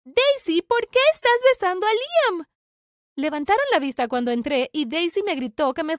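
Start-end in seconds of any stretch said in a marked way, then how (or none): 0.0-2.5 high, loud demand; outraged shout. A narrator's reading. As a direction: confront someone caught in betrayal. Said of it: "Y grité". (grito indignado, agudo y fuerte)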